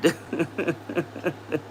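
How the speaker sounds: helpless, quiet chuckle; soft run of chuckles; laughing. Real, not acted. Streamer tickled by Clark Kent hair joke, laughing. Amused by the chat message.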